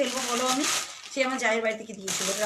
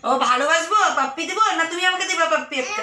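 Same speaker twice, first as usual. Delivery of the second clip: affectionate coaxing; 7 semitones higher, 8 dB louder than usual. Real, not acted. Mother asking her toddler for a kiss, affectionate baby talk. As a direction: ask sweetly for a kiss.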